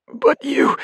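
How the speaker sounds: high strangled choked words; stabbed man choking out a protest, high; garbled gasp. Acted. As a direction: force it out while dying. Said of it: "barely managed a garbled".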